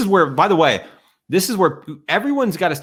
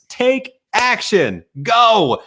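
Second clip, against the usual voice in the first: high, slow push to act now; urgent command; 8 semitones higher, 4 dB louder, much slower than usual. Real, not acted. Coach urging a friend to act, high and slow. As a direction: fire him up to take action. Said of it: "calling to act".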